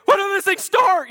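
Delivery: high, loud hammed-up panic; mock terror at a dead engine; panicky mock question. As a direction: squeal it like the boat is about to crash. Panicking boater drifting to rocks.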